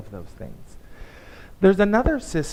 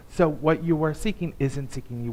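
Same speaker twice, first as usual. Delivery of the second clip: usual speaking voice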